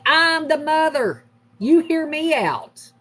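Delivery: high, slow, drawn-out mom retort; indignant retort. Retold or imitated. Mom pulling rank on her bossy son, indignant. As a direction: put your foot down, stretching each word high.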